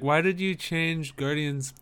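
normal talking voice

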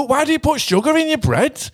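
ordinary talk, nothing marked

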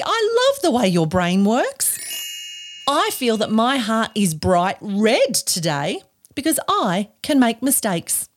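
ordinary delivery

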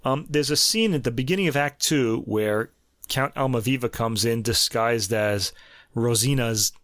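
ordinary delivery

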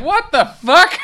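squealed high, loud through laughter; laughing disbelief. Real, not acted. Host reacting to the movie, high laughing squeal. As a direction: burst out laughing in shock.

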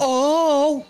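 put-on high happy oh for a baby. Shown as a demonstration. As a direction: coo it to a baby. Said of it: "if I go".

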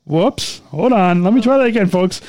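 ordinary delivery

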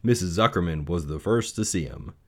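ordinary delivery, nothing marked

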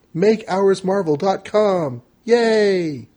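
upbeat sign-off, slow and high; cheery promo. Acted. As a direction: sell the plug with a bright cheer. Cheery podcast plug sign-off.

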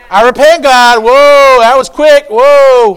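loud, high cry of repentance; booming acted alarm. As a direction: shout it up to God, startled. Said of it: "say it out loud".

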